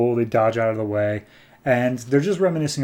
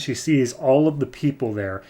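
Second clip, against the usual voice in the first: normal talking voice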